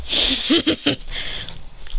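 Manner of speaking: voiceless, breathy put-on snicker; acted chuckle. Hero facing the charging parents, acted snicker. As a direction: fake a breath-only laugh.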